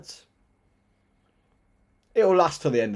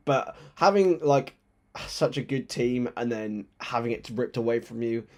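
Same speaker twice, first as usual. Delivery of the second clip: usual speaking voice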